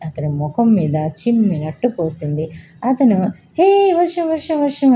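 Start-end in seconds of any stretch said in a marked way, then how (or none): 3.6-4.9 jolted high cry of surprise as water hits; much higher startled shout; startled awake. A narrator's reading. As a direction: yelp as if caught in sudden rain.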